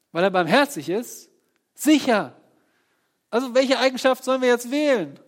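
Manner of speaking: loud, rising sermon build; fiery pulpit climax; full-force preaching. Acted.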